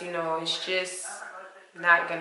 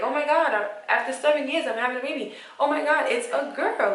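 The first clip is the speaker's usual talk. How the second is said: happy excited; 6 semitones higher than usual. Retold or imitated. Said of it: "because I was happy".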